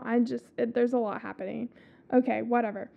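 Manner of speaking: ordinary delivery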